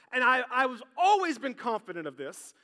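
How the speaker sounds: ordinary talk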